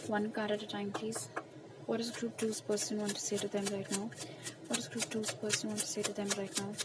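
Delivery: slow, soft, hushed asking of the cards; ritual question to spirit. Acted. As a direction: ask the spirit slowly and softly while shuffling. Tarot reader asks spirit.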